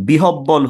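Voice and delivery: বিহব্বল with doubled b, bi-hob-bol; mistaken pronunciation. Shown as a demonstration. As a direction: sound h and hard b in বিহব্বল. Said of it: "ভুল".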